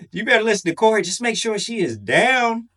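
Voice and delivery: usual speaking voice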